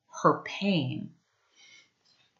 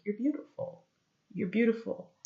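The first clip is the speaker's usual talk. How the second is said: higher, very soft choked-up voice; small, tearful voice, imitating being choked up; 5 semitones higher, 9 dB quieter than usual. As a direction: re-enact choking on the words, hushed and high. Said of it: "I was choking on my words".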